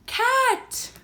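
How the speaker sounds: high, punchy animal reveal; excited reveal. Pretend. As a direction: announce it with delight.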